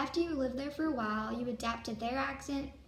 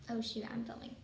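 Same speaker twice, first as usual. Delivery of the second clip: usual speaking voice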